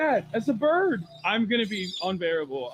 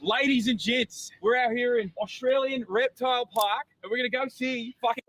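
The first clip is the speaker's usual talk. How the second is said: put-on lightly done Australian accent, higher, wildlife-host announcing; Crocodile Hunter Australian accent; 6 semitones higher than usual. Vlogger introducing the reptile park to camera, mild Australian accent. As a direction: play a hammed-up Aussie wildlife presenter introducing the park. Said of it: "Not an awful accent".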